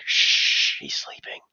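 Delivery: hushed shh and whisper. Retold or imitated. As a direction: whisper so the sleeping toddler won't wake. Hushing about sleeping drunk friend.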